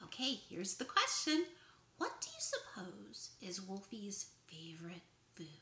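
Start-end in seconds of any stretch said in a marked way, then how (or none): 2.0-5.5 zacht fluisterend geheimzinnig gevraagd; whisper. Acted. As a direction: fluister de vraag als een geheimpje. Mysterious trivia question to kids.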